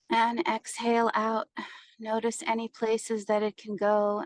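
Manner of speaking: even meditation voice with long pauses; guided meditation. Acted. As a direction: guide them through each breath, unhurried.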